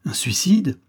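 a stunned, voiceless question; shocked whisper. Acted. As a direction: breathe it out, aghast at the idea. Prisoner shocked by poison offer.

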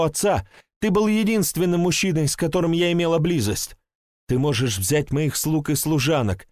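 pleading woman. Acted. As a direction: beg him to believe her. Woman pleading, swearing truth.

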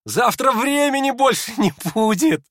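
very high, loud hysterical jeer. A narrator's reading. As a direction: fling it out shrilly, near hysteria. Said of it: "истерически усмехнулся Ипполит".

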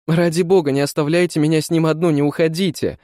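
high, rushed begging cry; frantic plea. A narrator's reading. Woman begs Mr Mann not to leave, frantic and high. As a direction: plead urgently, pitched well up. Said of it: "вскричала она".

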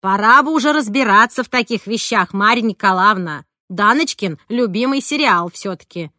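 loud, lecturing reproach; reproving older-woman character. Acted. Roza scolds Marya for not knowing Dana's serial, reproving. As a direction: chide her firmly and loudly. Voices Roza Vasilyevna in story.

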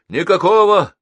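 high, loud, emphatic denial; shout. A narrator's reading. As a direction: shout it back in defiance. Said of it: "крикнул Геннадий".

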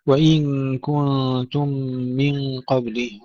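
وإن كنتم من قبله with long nasal hums on each n and m; full ghunna. Shown as a demonstration. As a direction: draw out every nasal in وإن كنتم من, slowly. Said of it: "الغنى المتتالية ننتبه إلى إعطائها كلها حقها". (وإن كنتم من قبله بغنن متتالية ممدودة)

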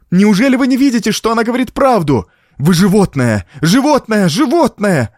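very high, fast, forceful outburst; beside herself, despairing. A narrator's reading. Niece defending the girl, despairing outburst. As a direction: cry insults in desperation. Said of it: "вне себя от отчаяния воскликнула Франсуаза".

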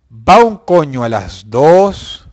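ordinary talk, nothing marked

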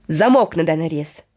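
plain reading voice

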